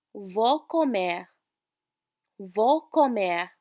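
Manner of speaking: Vou comer said slowly, syllable by syllable; slow model phrase. Shown as a demonstration. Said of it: "I'm going to eat".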